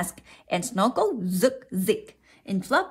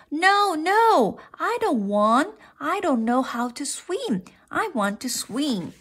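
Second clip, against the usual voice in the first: put-on protesting child; 7 semitones higher than usual. A narrator's reading. Froggy refusing mother's invitation to swim, high protest. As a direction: protest like a reluctant little one.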